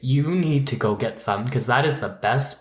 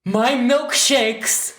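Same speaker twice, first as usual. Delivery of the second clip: high, loud, drawn-out exclamation; mock cry; 9 semitones higher, 4 dB louder, much slower than usual. Co-host shouting a skit punchline, mock cry. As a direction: shout it high and slow, hammed up.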